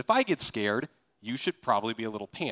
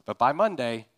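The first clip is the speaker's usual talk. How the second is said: normal talking voice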